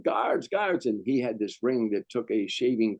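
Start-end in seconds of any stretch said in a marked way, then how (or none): 0.0-0.9 high-pitched mock yell for help; re-enacted yelling. Guard in a movie scene calling for help, mock yell. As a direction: call out for guards without full volume. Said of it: "I'm yelling".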